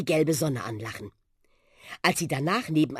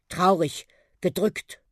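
plain reading voice

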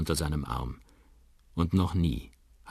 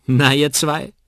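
laut und deutlich höher rufend; ruft; 10 semitones higher, 12 dB louder, slower than usual. A narrator's reading. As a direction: freudig zur Tür hinaus rufen. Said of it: "ruft Molly McGovern". (lauter Ruf zur Begrüßung)

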